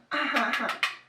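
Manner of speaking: high-pitched giggle; laughing at own pun. Real, not acted. Vlogger amused by an accidental pun, high laugh.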